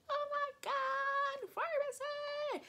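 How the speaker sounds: high, drawn-out mock scream of delight; retold excited scream. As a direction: imitate squealing over a prize, slow and loud. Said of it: "I was, like, screaming".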